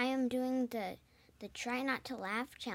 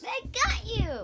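high, loud and slow gloating; triumphant; 12 semitones higher, 10 dB louder than usual. Real, not acted. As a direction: crow it like you won. Kid triumphant, thinks dad laughed.

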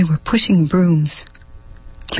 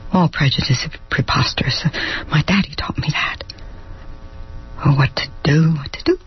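hushed self-talk; 2 semitones lower, 4 dB quieter, faster than usual. Acted. Troubled private musing.

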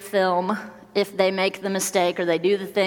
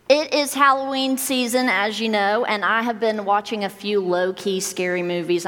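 ordinary talk, nothing marked

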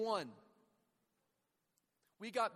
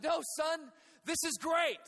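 higher, louder overjoyed dad; dad shouting with delight from a hole; cheerful dad shouting; 7 semitones higher, 5 dB louder than usual. Retold or imitated. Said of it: "But Dad, like".

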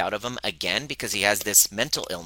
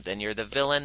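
ordinary delivery

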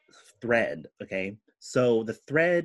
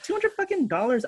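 high, disbelieving outburst; shocked disbelief. Retold or imitated. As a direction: squeak out the outrage at the price. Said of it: "I was like".

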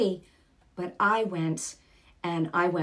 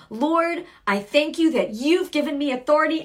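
loud declaring prayer; bold loud prayer; 7 semitones higher, 7 dB louder than usual. Retold or imitated. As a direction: proclaim it with force.

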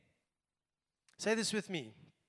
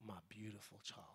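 tender; 12 semitones lower, 22 dB quieter than usual. Acted. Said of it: "he goes".

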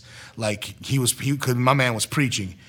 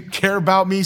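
usual speaking voice

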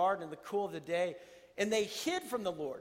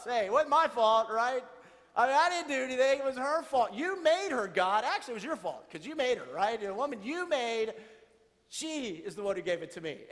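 mock blame-shifting; 3 semitones higher, 5 dB louder than usual. Adam blaming his wife to God, whiny and loud. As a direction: pass the blame, pleading innocence. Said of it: "He throws his wife under the bus".

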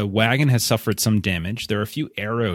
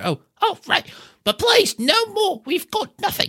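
high, pleading hobbit voice, much higher; panicked hobbit. Acted. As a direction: beg like a frightened little hobbit.

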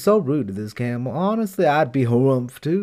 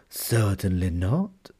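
much lower, slow, soft jinn voice; grave jinn; 8 semitones lower, 7 dB quieter, slower than usual. Acted. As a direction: answer with quiet solemn authority.

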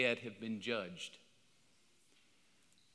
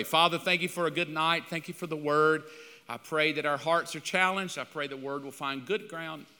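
closing prayer; 2 semitones higher, 4 dB louder than usual. Acted. Pastor praying over his church to close the night, earnest then softening. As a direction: pray over the congregation.

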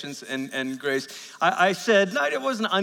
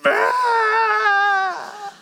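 high far-off mock wail, like the four-year-old; mock child wail; 5 dB louder than usual. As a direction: cry out like a child caught in a mess. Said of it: "we're like".

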